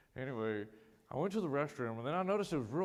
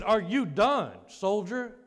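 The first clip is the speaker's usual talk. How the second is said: loud, higher military demand; drill-sergeant shout; 8 semitones higher, 11 dB louder, much slower than usual. Retold or imitated. Infantryman father repeating the challenge to the patient, loud command. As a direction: shout it at a soldier who's slacking.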